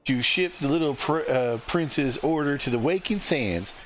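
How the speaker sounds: put-on high-pitched hearty Southern accent. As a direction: play a cheerful Southern innkeeper in a high Southern accent. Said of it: "giving her a southern accent".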